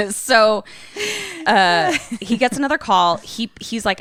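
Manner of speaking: ordinary delivery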